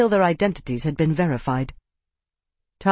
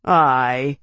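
drawled; 4 semitones lower, 4 dB louder than usual. A narrator's reading. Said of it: "Tavi drawled".

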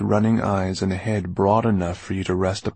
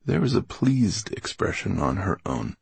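ordinary delivery, nothing marked

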